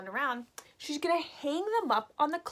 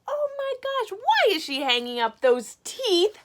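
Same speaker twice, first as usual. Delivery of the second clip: much higher oh my gosh; mock shock; 8 semitones higher, 5 dB louder than usual. Teacher shocked at the teeth on the line, mock shock. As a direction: squeal it in playful disbelief.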